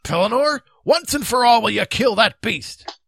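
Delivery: high, loud ultimatum; exasperated demand. A narrator's reading. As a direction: demand it loudly, out of patience. Stranded knight demands action.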